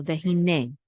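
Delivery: vehine with the weight on ne; stress on last syllable. Shown as a demonstration. Teacher showing where the accent is, final stress. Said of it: "the accent is on the last syllable".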